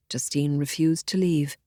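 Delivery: plain reading voice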